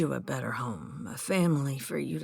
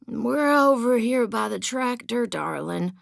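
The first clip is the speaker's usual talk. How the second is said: gruff call; 8 semitones higher, 7 dB louder than usual. A narrator's reading. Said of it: "Joe said gruffly".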